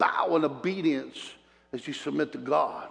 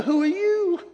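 higher, laughing who are you; amused mock question; 3 dB louder, slower than usual. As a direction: toss it off with a laugh. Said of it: "I didn't get a".

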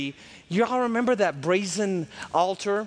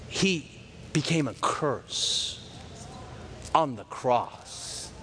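solemn slow; 5 semitones lower, 5 dB quieter, much slower than usual. Real, not acted. Solemn slowed gospel point.

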